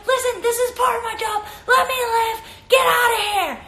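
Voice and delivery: imitated high, exasperated yelling; Alyssa Nair. Host as goalkeeper telling teammates off, exasperated yelling. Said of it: "She's yelling at her teammates".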